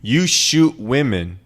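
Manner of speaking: "You shoot women" drawn out, each word stressed; strong emphasis. Real, not acted. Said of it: "saying it for emphasis".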